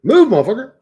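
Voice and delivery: loud, high burst of irritation; exasperated shout. Real, not acted. Streamer fixing typed prompt, real exasperated shout. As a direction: yell impatiently at a stuck cursor. Snapping at the computer while typing.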